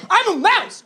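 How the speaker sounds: high squeaky mouse yell; squeaky mock yell; yelled high squeak. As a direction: shriek it like a scared little mouse. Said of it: "And I yelled".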